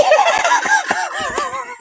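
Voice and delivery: very high, breathless laughter; squealing laugh. Real, not acted. Streamer laughing at potato head joke, squealing. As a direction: burst into a helpless high laugh.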